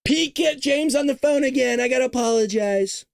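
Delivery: high mock president with fake contrition; Trump impression. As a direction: set up a fake apology, Trump-style. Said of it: "you know what he's gonna do?".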